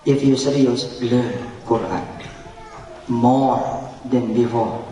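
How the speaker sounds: low, slow stern inner voice; a hint of a stern inner teacher. Challenging himself, low and slow. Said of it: "Saya bilang".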